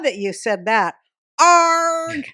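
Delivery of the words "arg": pirate growl. Pretend. Pirate growl after story.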